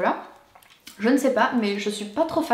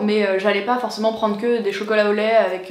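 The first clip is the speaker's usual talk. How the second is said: ordinary talk, nothing marked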